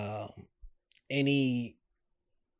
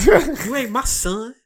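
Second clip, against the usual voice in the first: slow, higher mock movie voice; movie quote; 9 semitones higher, 6 dB louder, slower than usual. Replaying the movie line for his friend, slow and hammed. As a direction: drag it out like the film.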